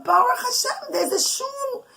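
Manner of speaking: high, bright burst of joy at the sight; he can't believe his luck, lifted high; delighted exclamation. A narrator's reading. Mother telling her kids the story, character's delighted gasp.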